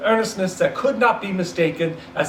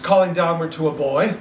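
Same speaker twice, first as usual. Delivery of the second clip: usual speaking voice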